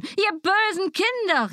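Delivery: mock fury at the children; scolding stepmother. A narrator's reading. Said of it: "Sie begann sofort zu schimpfen".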